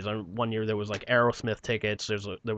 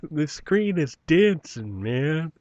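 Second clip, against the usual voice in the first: mock stoned; 4 semitones higher, much slower than usual. Joking about being on drugs.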